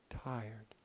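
breathy whisper on tired. Real, not acted. Pastor quoting God's promise, ending in a whisper. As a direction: let it fade to a whisper.

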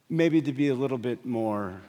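normal talking voice

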